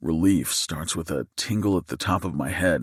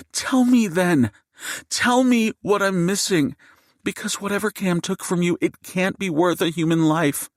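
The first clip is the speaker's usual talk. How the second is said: seething fury; 12 semitones higher than usual. Acted. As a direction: hurl the words at him, voice pitched way up. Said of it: "Jade's seething voice".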